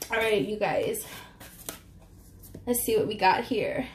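normal talking voice

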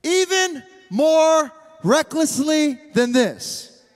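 emphatic, unrepentant defiance; spicy comeback. Acted. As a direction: punch it out proudly, word by word. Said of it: "Real spicy".

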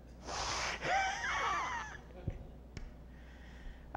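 a shrill crowing laugh that falls away; crowd-pleasing cackle; high laugh. Real, not acted. Laughs after joke to Jeff.

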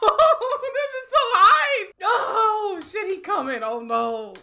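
loud, high shouting through laughter; hyped screaming. Real, not acted. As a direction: lose it with excitement. Hype at villain coming.

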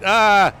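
sudden loud high yelp; real alarmed cry at a near crash; startled yell. Real, not acted. Driver cut off on the road, sudden loud yelp. Another driver cuts him off.

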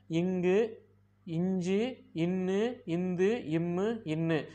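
"இங்கு, இஞ்சு, இன்னு, இந்து, இம்மு, இன்னு" softly through the nasal sounds; soft consonants. Shown as a demonstration. Teacher showing how the six sound soft, gentle nasals. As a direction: ease gently into each nasal, one by one. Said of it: "ரொம்பவும் மென்மையா ஒலிக்கும்".

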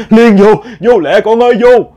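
high, loud urgent call to Liên; frantic yell. Retold or imitated. Grandmother yelling at Liên to get inside, high and loud. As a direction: shout her inside before the ghost gets her. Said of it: "bà mới la làng".

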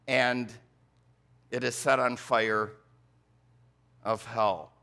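solemn scripture reading from the pulpit. Acted. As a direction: read the verse with weight. Reads scripture verse aloud.

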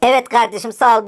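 ordinary talk

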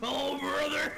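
high loud wrestler catchphrase; wrestler shout. Retold or imitated. More Hulk Hogan impression.

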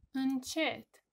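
usual speaking voice

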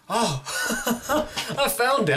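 laughing triumphant whoop; excited laugh. Real, not acted. Gamer finds the way out, laughing triumphantly. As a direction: burst out laughing with relief.

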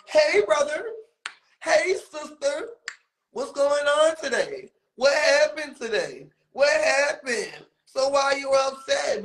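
high, singsong mimicking voice; mock friendly greeter. Mimicking J-Rock greeting people, high and falsely friendly. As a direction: chirp it high as if innocently checking in. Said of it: "talking about something".

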